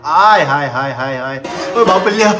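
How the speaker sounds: loud, drawn-out wow at a delicious smell; delighted exclamation. Real, not acted. Husband reacting to the kitchen aroma, loud delight. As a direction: exclaim with big, loud relish.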